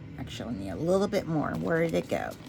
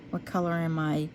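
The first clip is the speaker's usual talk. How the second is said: ordinary delivery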